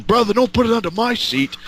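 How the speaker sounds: high-pitched mock panic; imitating a worried passenger, high; retold alarmed protest. Retold wrestler's protest over rolling beers.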